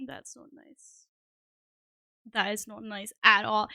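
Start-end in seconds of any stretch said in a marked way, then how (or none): 0.1-1.1 muttered under breath. Real, not acted. Kicked out of account.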